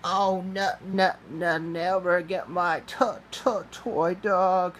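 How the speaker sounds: acted high, stuttering sob; sobbing. A narrator's reading. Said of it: "Ryan sobbed".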